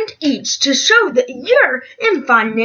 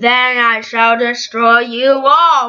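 deep, booming villain emperor; menacing tyrant proclaiming doom; evil emperor voice; 6 semitones lower than usual. Pretend. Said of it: "I am Emperor Bob".

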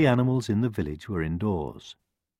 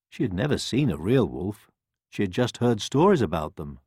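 plain reading voice

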